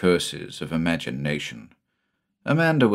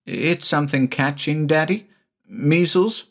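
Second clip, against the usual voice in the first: put-on high rising child's questions; a boy anxiously guessing; high questioning child voice; worried little boy; 8 semitones higher than usual.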